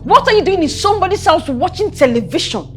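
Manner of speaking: high-pitched indignant rebuke; angry scolding. Acted. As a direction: tell her off heatedly. Scolding a daughter.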